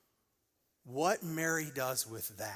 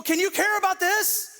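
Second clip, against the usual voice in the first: pleading believer; 12 dB louder than usual. Retold or imitated. Us pleading with God.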